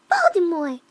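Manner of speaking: loud, booming reveal of a villain; dramatic exclamation. Acted. Narrator revealing who the horrible man is, loud dramatic exclamation. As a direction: cry out the villain's name.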